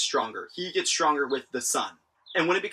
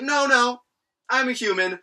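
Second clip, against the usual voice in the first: raised, light voicing of Escanor insisting; mock insistence; 9 semitones higher, 4 dB louder than usual. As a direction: insist a bit higher, half-playful. Escanor denies being inhuman.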